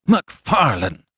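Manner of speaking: mildly shocked exclamation of a name; crying out. A narrator's reading. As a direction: cry out the name in protest, without shouting. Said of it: "cried Fettes".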